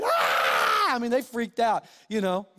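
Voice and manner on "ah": short mock scream of panicked passengers; imitated passenger scream. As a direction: belt out a brief panicked "Ah". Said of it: "Everybody else is screaming".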